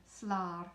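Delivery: Slar with an open long A, like slar. Shown as a demonstration. Teacher drilling the vowel in Slar. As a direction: stretch the A in Slar. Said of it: "Slar is that A with the E together".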